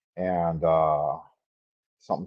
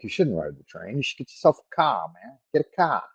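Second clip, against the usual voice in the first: higher-pitched Boston boss impression, r's dropped; Boston accent; 5 semitones higher than usual. Old floor manager advising him in a Boston accent. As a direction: play it up like an old Boston manager, "pahk yah cah". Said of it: "he's like".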